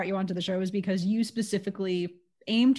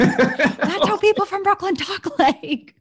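laughing; 12 semitones higher, 7 dB louder, slower than usual. Real, not acted. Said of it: "laughing reply".

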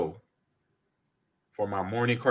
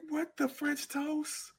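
put-on baffled kid; 10 dB quieter, much slower than usual. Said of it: "I was like".

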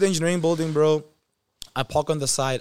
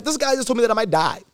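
mock panic; 9 semitones higher, 6 dB louder than usual. Panicked reaction to doctor.

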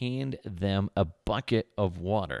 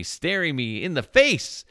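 mock horrified; 10 semitones higher, 7 dB louder than usual. Horrified at boss's naked photo.

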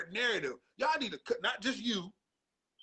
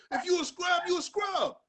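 high, loud emphatic yell; emphatic shout; 9 semitones higher, 3 dB louder than usual. Real, not acted. Judging a bust player, emphatic shout. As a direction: hammer home the verdict.

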